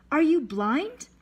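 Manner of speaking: high, loud rising question; shocked gasp. A narrator's reading. Sailor to the boy on the beach, gasping in shock. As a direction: blurt it out in shocked disbelief. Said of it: "He gasped".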